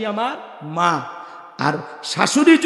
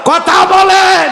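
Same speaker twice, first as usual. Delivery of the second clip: high, loud call to the crowd; preacher shouting to his listeners to respond; rousing shout; 11 semitones higher, 5 dB louder, much slower than usual. Real, not acted. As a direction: bellow it out to the whole gathering.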